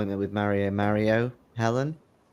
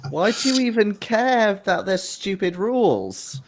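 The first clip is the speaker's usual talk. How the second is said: indignant retort; 9 semitones higher than usual. Acted. Petulant NPC retort.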